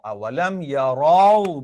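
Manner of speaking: "yarau" slow, rounded towards o; rounded o sound. Shown as a demonstration. Teacher shows the o sound, "yarau" with rounded ending. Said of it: "yang berbunyi o".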